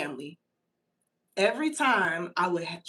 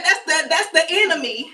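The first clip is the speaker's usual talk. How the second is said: higher, louder indignant rebuke; loud, lightly hammed protest; mock rebuke; 7 semitones higher, 6 dB louder than usual. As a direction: cast it off like a preacher. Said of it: "I said".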